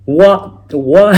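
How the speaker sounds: high, loud baffled what, why; a shocked double take at the score; surprised. Retold or imitated. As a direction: exclaim in loud disbelief. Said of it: "I was like".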